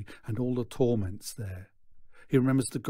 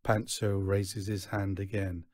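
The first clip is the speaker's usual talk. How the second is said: ordinary delivery, nothing marked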